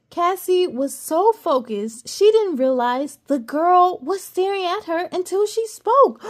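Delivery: plain reading voice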